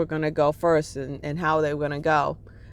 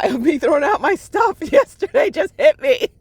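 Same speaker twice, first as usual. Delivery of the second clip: higher, louder laughter breaking through the words; emotional laughing; 9 semitones higher, 6 dB louder than usual. Real, not acted. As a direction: laugh it out while the memory hits you. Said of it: "I'm, like, getting emotional now".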